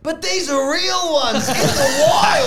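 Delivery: high, slow, loud protest; imitated yelling. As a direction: mimic a friend hollering, stretched out. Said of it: "you keep yelling like".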